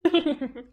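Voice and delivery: short mocking villain chuckle; high gloating laugh; sneering laugh. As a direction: laugh at her misery. Villain mocks betrayed lover.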